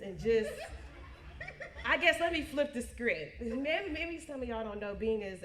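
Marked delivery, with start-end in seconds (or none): 0.5-1.8 high airy laugh. Real, not acted. Laughing at own teasing.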